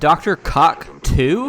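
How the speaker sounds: drawn-out, slow, incredulous question; mock disbelief. Riffing on the doctor's name, drawn-out mock disbelief. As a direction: stretch it out like a punchline.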